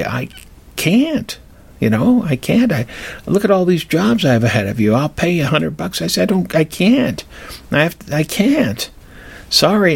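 high, pleading "I can't" to a desperate customer; apologetic refusal. Retold or imitated. Refusing a weeping law student's rush job, high and apologetic. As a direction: beg off the rush job, pitch up, a little helpless.

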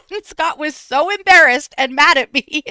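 high, loud talk through laughter; real laughing fit over the words; helpless laughter. Real, not acted. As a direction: squeeze the words out while cracking up. Breaking up at own story.

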